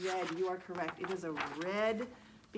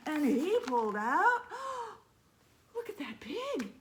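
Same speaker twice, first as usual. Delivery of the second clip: louder, higher build to the pig; excited reveal; 7 semitones higher, 8 dB louder than usual. A narrator's reading. Reader revealing the pig, louder then higher. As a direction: build up to a big surprise.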